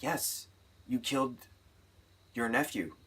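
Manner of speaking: lightly voiced nun, soft and stern; a hint of an accusing nun, hushed. Nun telling Pepper she killed her nephew, quiet and stern. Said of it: "Lily Rabe's character's like".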